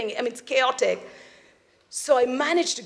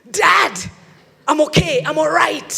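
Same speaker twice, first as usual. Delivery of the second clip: louder, higher shout over the chaos; calling out; 7 semitones higher, 8 dB louder than usual. Retold or imitated. Son shouting to his dad over the noise. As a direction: shout down the phone to reassure.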